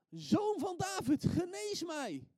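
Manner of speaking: high, loud, stretched-out plea; crying out. Acted. Preacher acts what Bartimeus could have called, high loud cry. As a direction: shout it slowly for all to hear. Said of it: "dat hij niet riep".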